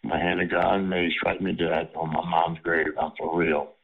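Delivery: ordinary talk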